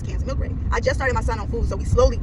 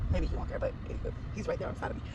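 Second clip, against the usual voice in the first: hushed whisper, much softer; 6 semitones lower, 13 dB quieter than usual. Real, not acted. Vlogger whispering with police parked right beside her. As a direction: keep it low so the officer won't hear. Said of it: "trying to record a video with the police right next to me".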